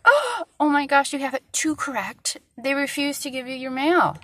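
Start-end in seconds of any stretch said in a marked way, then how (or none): 0.0-2.4 high, exaggerated scandalized gasp of words; mock horror. Mocking post office staff who refuse mail, mock horror. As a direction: mimic someone appalled, starting high and loud. Mock outrage at a correct address.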